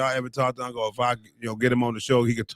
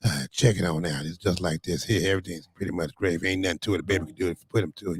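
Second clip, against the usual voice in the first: Unc's seventies slang voice; 6 semitones lower than usual. Retold or imitated. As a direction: lay it back like a smooth seventies uncle. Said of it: "so that's how Unc talk".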